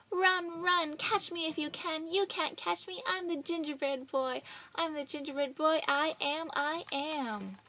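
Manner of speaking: mock the chasers as you run; taunting call. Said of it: "looked back and laughed and called".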